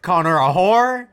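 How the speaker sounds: loud, high shocked outcry. Real, not acted. Streamer shocked by the insult on screen, loud disbelief. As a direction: gasp it out in disbelief.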